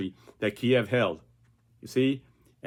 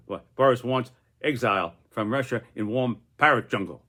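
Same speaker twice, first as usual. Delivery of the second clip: ordinary talk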